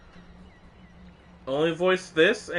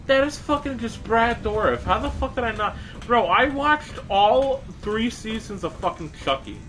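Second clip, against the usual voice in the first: high, excited disbelief; sudden realization; 9 semitones higher than usual. Real, not acted. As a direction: burst out in amazed recognition. Realizes the voice actor's identity.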